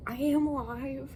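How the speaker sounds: slow, loud, high proclamation; mock-grand morning announcement; dramatic proclamation. Real, not acted. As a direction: declare it like a rebirth.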